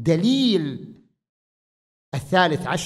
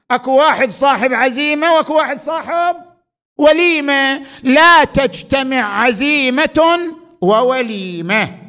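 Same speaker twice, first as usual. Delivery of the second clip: preaching at full force; 9 semitones higher, 8 dB louder than usual. Acted. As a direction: hammer home the saying like a preacher. Preaching returns to the maxim. (خطابة بصوت عال ومرتفع)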